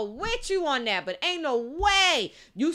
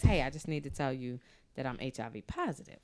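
much lower, softer, confessional; 11 semitones lower, 9 dB quieter than usual. Acted. Man confessing to his date in the bedroom, low and hushed. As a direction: drop low and soft to break hard news. Acts out partner's grave confession.

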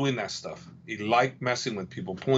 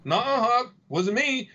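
much higher whiny denial; mock innocent D C; 10 semitones higher than usual. D C denying everything to Hop, high whiny innocence. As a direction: pitch way up, play innocent. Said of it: "He goes".

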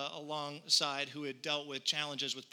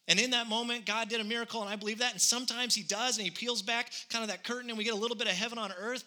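preaching; 5 semitones higher, faster than usual. Acted. Preacher telling the church about a miracle, rising preaching. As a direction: lift the congregation toward heaven.

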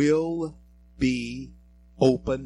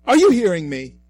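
shouted, demanding question; loud challenge; 5 semitones higher, 13 dB louder, faster than usual. Real, not acted. Host to listeners, demanding they listen, loud. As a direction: call them to attention.

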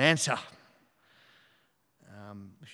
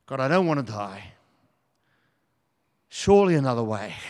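acted plea with loud onsets, falling away; pleading in dread; 7 dB louder than usual. Preacher voices Jesus in the Garden of Gethsemane, pleading. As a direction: plead with God for a way out. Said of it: "Jesus in the Garden of Gethsemane".

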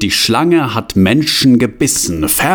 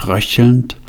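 ordinary delivery, nothing marked

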